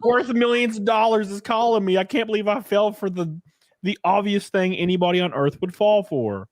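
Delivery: mock her as dazzled by a star; mock starstruck wife. Podcaster mocking the wife about the star, in a starstruck mock voice.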